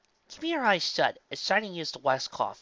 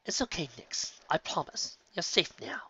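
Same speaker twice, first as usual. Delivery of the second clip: soft, slightly raised soothing voice; calm, sweet voice; 4 semitones higher, 3 dB quieter than usual. A narrator's reading. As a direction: soothe a frightened child like a gentle breeze. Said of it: "spoke in a calm, sweet voice".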